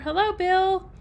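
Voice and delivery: normal talking voice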